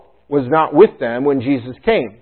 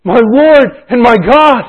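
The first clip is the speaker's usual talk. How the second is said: awed declaration; 11 semitones higher, 13 dB louder, slower than usual. Retold or imitated. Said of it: "He says".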